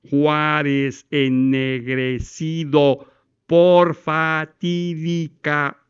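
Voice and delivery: "Juárez ennegrecido por fatídica" spoken very slowly, every syllable carved with big jaw moves; exaggerated articulation. Shown as a demonstration. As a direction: stretch the mouth wide over each sound of "Juárez ennegrecido". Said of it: "Exageren los movimientos maxilofaciales".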